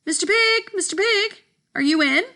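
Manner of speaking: high sing-song call at the door. Retold or imitated. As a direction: call the pig sweetly from outside. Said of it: "I called".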